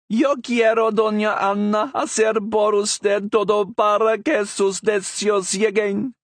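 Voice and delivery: high, loud birdlike voice; parrot speaking Spanish. Acted. Parrot answering the Queen in Spanish, high bird voice. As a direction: squawk it proudly in Spanish.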